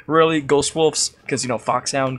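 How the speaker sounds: loud scoff. Acted. Snake scoffs at the colonel.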